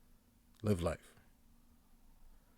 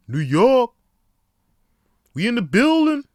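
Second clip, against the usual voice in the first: high, loud hype-man shout of New York; catchphrase shout; 11 semitones higher, 11 dB louder than usual. Shown as a demonstration. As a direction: yell it like you own the room. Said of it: "I just say New York".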